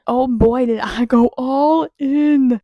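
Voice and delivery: loud, drawn-out enthusiasm; big, slow, showy boast; hyped exclamation. Real, not acted. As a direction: belt it out, stretching the last words. Hyped pun on book title.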